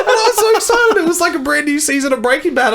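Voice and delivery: very high-pitched, giddy gushing; giddy excitement. Real, not acted. Fan recalls a brand new season arriving, giddy high excitement. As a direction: gush in a squeaky high register.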